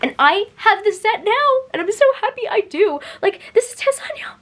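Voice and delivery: giddy high-pitched delight; excited squeal. Real, not acted. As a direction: gush like a thrilled fan. Showing off favourite character's edition.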